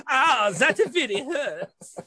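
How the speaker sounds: high, drawn-out mock moan of pain; novelty pencil holder's voice. Re-enacting shoving pencils in the holder, mock pained moan. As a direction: stretch it into a slow, high toy groan. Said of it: "he would go".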